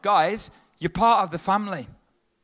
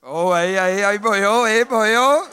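cheering; 7 dB louder than usual. Real, not acted. Cheering Welsh shout.

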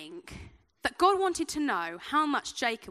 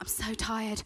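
softer, lower, worn-out reply; acted weariness; 4 semitones lower, 7 dB quieter, slower than usual. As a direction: give in, as if worn out. Acts giving in to tiredness.

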